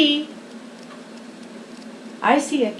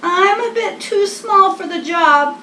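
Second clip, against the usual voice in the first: higher little bird voice; small Wren admitting defeat; high bird voice, a bit rueful; 7 semitones higher, 4 dB louder than usual. Acted. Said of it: "said Wren".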